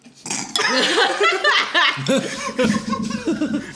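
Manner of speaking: long laugh breaking out high; high burst of laughter. Real, not acted. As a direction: crack up laughing. Mouth full during intro.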